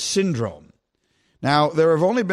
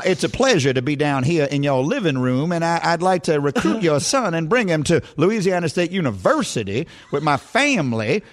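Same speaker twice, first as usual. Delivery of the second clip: put-on Brian Kelly's Southern accent. Coach pitching parents to recruit their son, mock Southern accent. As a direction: do your best amateur Southern accent. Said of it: "I'm doing the best Southern accent I can".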